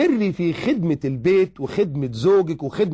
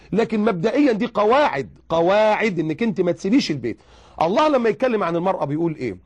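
ordinary talk

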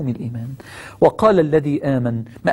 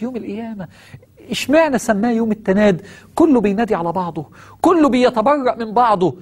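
ordinary talk, nothing marked

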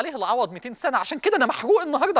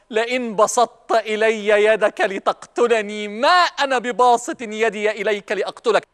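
slow, lower-pitched solemn recitation; Quran quotation; 3 semitones lower, slower than usual. Acted. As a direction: stretch out each word of the verse.

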